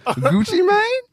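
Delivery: very high-pitched disbelieving question; incredulous. Real, not acted. Reacting to who is on the list, high-pitched incredulity. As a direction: squeal it in disbelief, rising at the end.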